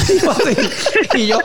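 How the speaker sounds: high, laughing through the words. Real, not acted. Retelling what his partner said, laughing high.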